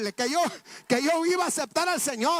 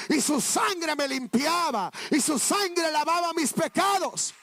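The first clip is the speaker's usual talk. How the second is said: preaching shout; 2 semitones higher, slower than usual. Real, not acted. Preacher to congregation, celebrating his cleansing, slow rising shout. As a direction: proclaim it to the rafters.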